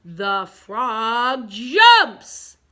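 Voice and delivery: very slow build to booming JUMPS; loud exclamation. Shown as a demonstration. Teacher leads pupils reading the exclamation, slow and loud. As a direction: draw it out, then burst loud. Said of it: "Exclamation point".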